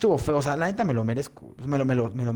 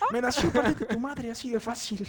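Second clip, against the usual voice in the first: mock outrage; 10 semitones higher, 3 dB quieter than usual. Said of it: "Y yo".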